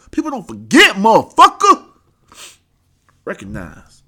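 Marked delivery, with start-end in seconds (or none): 0.1-1.9 high, loud shouted threat; shouted gloating warning; yelled taunt. Acted. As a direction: yell it in his face, taunting. Repeats taunt, now yelled.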